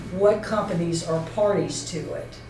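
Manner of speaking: ordinary talk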